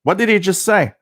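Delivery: high, mock puzzled friend pestering; friends asking. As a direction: pitch it up like a confused friend nudging you. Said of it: "coming up to me and saying".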